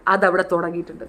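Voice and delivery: ordinary delivery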